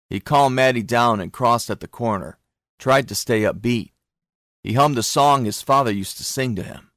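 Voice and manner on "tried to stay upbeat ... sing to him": ordinary delivery, nothing marked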